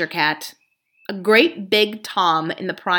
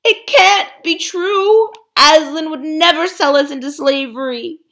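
dismayed beasts; 9 semitones higher, 7 dB louder than usual. Acted. Beasts protest the ape.

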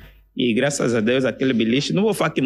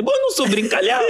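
mock defensive self; 13 semitones higher, faster than usual. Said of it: "Um gajo a se defender".

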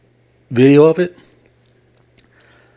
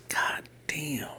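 whispered under the breath in shock; awed whisper; 6 semitones higher, 15 dB quieter than usual. Retold or imitated. Stunned by the boxcar video, whispered god damn.

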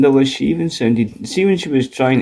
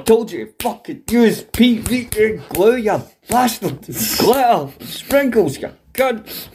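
high, mock-angry crafty presenter; squeaky exasperated art teacher swearing; mock Neil Buchanan rant; 9 semitones higher than usual.

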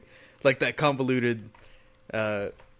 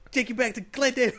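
retold rant; 9 semitones higher than usual. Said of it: "He just goes off on it".

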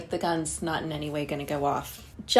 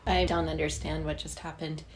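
normal talking voice

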